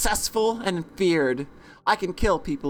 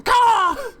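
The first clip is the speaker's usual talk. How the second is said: high, loud crow squawk; crow caw; 13 semitones higher, 6 dB louder than usual. Acted. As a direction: let out a piercing crow call. Crow call interjected while reading.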